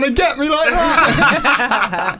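mock high voice. Mock voice of pretend account.

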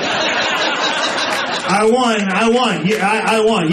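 high, laughing victory cry; gleeful gloat. Real, not acted. Bragging to the audience about beating the attendant, gloating. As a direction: crow about winning.

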